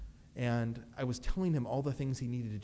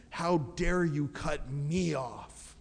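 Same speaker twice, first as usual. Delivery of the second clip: mock road rage; 2 semitones higher, much slower than usual.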